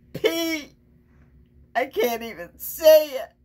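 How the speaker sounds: lightly voiced hungry truck savouring its menu; greedy truck. Truck reciting its recipe to the kids, lightly relishing. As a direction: relish each gross item like a treat.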